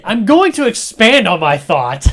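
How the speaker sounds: loud high pompous guest; hammed-up guest; mock guest. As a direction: puff it up self-importantly. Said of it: "And you say".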